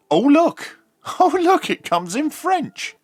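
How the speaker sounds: ordinary talk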